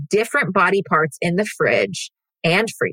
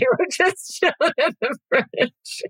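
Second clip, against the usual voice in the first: high laughing delivery; laughing pun; 9 semitones higher than usual. Real, not acted. Owning the accidental pun with a laugh. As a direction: giggle through the pun. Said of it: "was that a joke".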